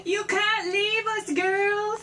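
high, slow whiny plea; mock whining plea. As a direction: whine it like a sad goodbye. Pleading slow high whine.

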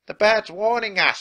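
high, loud pirate warning; alarmed shout. A narrator's reading. Pinky warns the crew about the bird, shouting. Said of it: "shouted Pinky".

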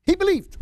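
"believed" thrown high and loud; emphatic exclamation. Real, not acted. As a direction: drive home "believed" with a high, loud punch. Drives home the key point.

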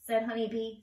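ordinary delivery, nothing marked

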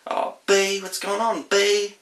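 high, loud Kiwi greeting; broad kiwi accent. Shown as a demonstration. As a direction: call out to a mate, New Zealand style. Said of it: "that's a bit of a Kiwi speak".

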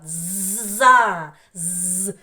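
long buzzing з, opening into a loud за; sounding out a syllable. Shown as a demonstration. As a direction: hold the з, then release за. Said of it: "другий склад". (протяжне з, потім голосне за)

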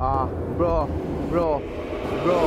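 loud, stunned bro repeated; excited. Real, not acted. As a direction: hype shout at the screen. Reacts to chapter ending.

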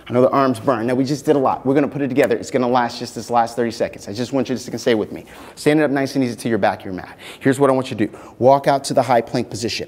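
upbeat coaching voice; aerobics instructor. Acted. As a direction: coach the class through the moves. Fitness class coaching.

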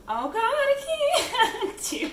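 real emotion breaking through laughter; choked-up laugh, voice giving way; laugh-crying. Real, not acted. Saying goodbye to the kitchen, laughing through tears.

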